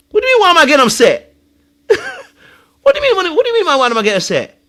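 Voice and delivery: loud, fast, high indignation; indignant retort. Acted. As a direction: fire it back, outraged. Acting Alan's indignant reply.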